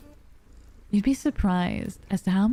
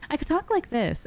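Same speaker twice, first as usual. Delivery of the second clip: high-pitched, peppy demo voice; energetic, much higher voice; 8 semitones higher than usual. Shown as a demonstration. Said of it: "Very ex-, energetic".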